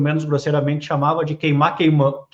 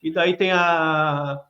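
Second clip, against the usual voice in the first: ordinary talk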